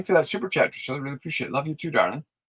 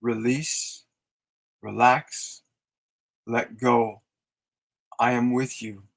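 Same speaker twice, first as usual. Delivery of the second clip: soothing mantra; 4 semitones lower, slower than usual. Shown as a demonstration. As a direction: breathe it out tenderly to the feeling. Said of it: "say to the feeling of depression".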